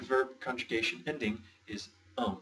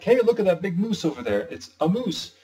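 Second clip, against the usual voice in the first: louder and higher, hammed-up excited pointing; mock excited; 7 semitones higher, 6 dB louder than usual. Teacher hamming up an excited moose sighting, louder and higher. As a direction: pretend to spot a huge moose.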